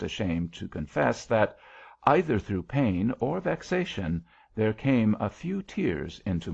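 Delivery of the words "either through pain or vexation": usual speaking voice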